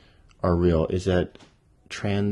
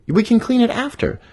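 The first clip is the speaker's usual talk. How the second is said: very high, pleading squeak; high pleading bunny; 5 dB louder than usual. Acted. Bunny begs the badger, very high plea. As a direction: beg him to stay.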